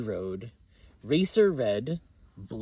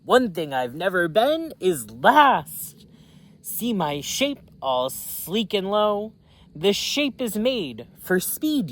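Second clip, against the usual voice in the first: high, loud brush-off; cocky racer voice; 6 semitones higher, 5 dB louder than usual. Acted. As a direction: toss it off like a proud speedster. Racer Red bragging.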